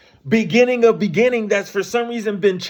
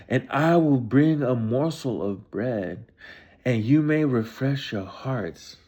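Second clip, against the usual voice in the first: solemn scripture reading; 8 semitones lower, 4 dB quieter than usual. Acted. Reading Bible passage aloud.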